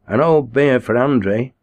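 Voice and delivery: a pub regular calling out for a beer. A narrator's reading. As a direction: call the order to the bar. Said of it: "called someone".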